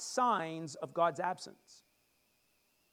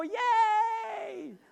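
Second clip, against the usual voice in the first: high, loud cheering yay; mock crowd cheer; 12 semitones higher, 5 dB louder than usual. Crowd cheering at the good news, loud high cheer. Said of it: "Everybody go".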